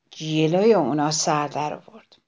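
usual speaking voice

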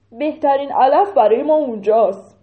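loud cow voice; a cow calling out to the herd; cow speaking. Acted. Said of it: "یکی از گاوا گفت". (صدای گاو)

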